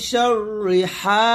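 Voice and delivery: slow drawn-out chanted recitation; tajweed recitation. Shown as a demonstration. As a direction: stretch each syllable like recitation. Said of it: "হারা চাপ দিয়ে বলবেন".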